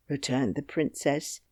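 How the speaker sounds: usual speaking voice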